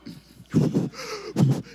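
very high, loud drawn-out gasp; shocked gasp. Retold or imitated. Told his plane left, gasping loudly. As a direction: gasp in disbelief. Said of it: "I went".

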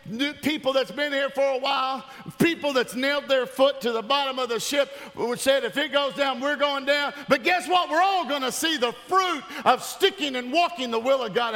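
high, driving preacher's delivery; rally the congregation, building urgency; full-force preaching. Real, not acted.

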